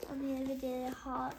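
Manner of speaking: plain reading voice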